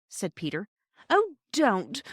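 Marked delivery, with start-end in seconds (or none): 1.1-2.0 high, loud pleading cry; cried out. A narrator's reading. Roberta begging her brother to stop, high and loud. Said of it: "cried Roberta".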